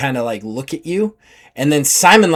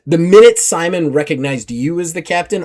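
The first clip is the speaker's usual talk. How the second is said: normal talking voice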